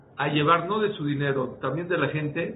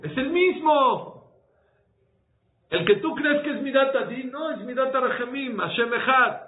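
fervent preaching; 7 semitones higher than usual. Acted. Rabbi preaching the Shema to his congregation, fervent and raised. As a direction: preach the Shema with rising fervor.

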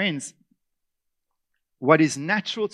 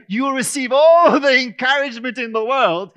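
mock proclamation; 7 semitones higher, 10 dB louder than usual. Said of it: "sit and say".

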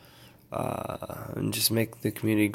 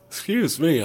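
through a yawn; 7 semitones higher than usual. Real, not acted. Apologizing to viewers for a tired yawn, yawning. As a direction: excuse me mid yawn, pitched up. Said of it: "I'm yawning".

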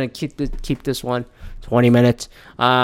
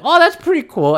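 high-pitched, louder admiring reaction; impressed; 13 semitones higher, 5 dB louder than usual. Retold or imitated. Other people seeing his new hoodie, impressed. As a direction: imitate friends impressed by the hoodie.

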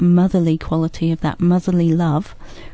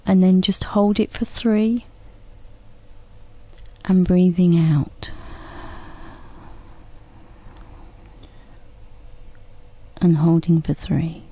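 guided breathing; 3 dB quieter than usual. Shown as a demonstration. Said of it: "So you can just follow along with us".

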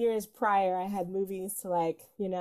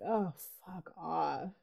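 muttered fuck off; 4 semitones lower, 8 dB quieter, much slower than usual. Retold or imitated. Quoting own reaction online.

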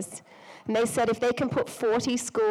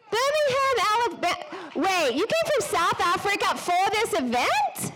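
high-pitched, astonished exclamation; amazed; 8 semitones higher than usual. Real, not acted. Host hearing how far guests travelled, amazed. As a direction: react in real disbelief, pitch leaping up.

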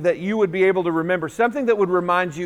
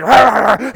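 gruff imitation of a growling dog; 6 dB louder than usual. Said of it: "He's got this growl going on".

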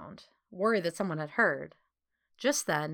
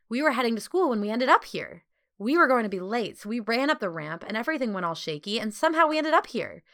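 lively run-on chatter building louder and higher; chatterbox; 5 semitones higher, 6 dB louder than usual. A narrator's reading. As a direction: gush out the whole story, ending in a loud plea. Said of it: "back to her chatterbox self".

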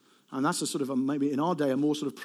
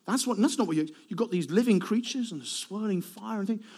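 ordinary delivery

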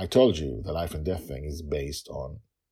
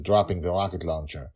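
plain reading voice

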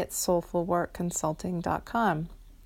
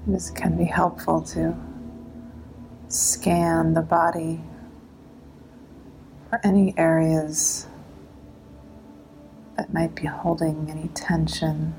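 guided meditation; 4 dB louder than usual. Acted.

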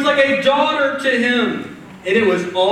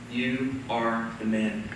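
slow, much softer and lower reveal; dramatic hush; 11 semitones lower, 11 dB quieter, slower than usual. Acted. Nathan confronting King David, slow and hushed. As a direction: drop it quietly, letting each word land. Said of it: "paused for dramatic effect".

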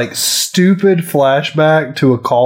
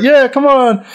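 higher, cheering fan voice; root for him from the couch; mock cheering; 11 semitones higher, slower than usual. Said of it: "I was like".

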